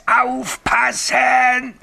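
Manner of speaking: very high, drawn-out scream; screamed warning. Acted. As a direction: stretch the word into a high ghostly shriek. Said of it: "schrie es". (langgezogener, gellender Warnschrei)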